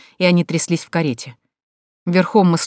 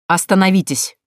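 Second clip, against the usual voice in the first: angrily; 2 semitones higher, 3 dB louder than usual. Acted. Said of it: "гневно прервал его Саффолк".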